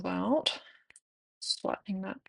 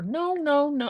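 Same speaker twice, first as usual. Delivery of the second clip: put-on high, babyish repeated no; toddler saying no; 10 semitones higher, slower than usual. Mom imitating her toddler refusing bed, high babyish no.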